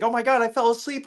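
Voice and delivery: high whiny imitation of a sobbing husband; mock crying husband. Ex-husband who crashed the car, imitated high and whiny. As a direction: mimic him wailing, hammed-up and high. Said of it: "he starts crying".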